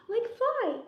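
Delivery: sharp excited gasp, voice jumping high; gasp of wonder. Acted. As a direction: gasp as if the idea just struck. Bug has a sudden idea.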